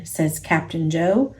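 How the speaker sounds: usual speaking voice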